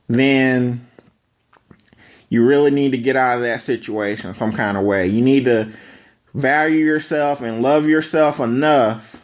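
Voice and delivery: ordinary delivery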